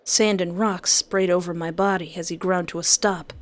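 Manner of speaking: ordinary delivery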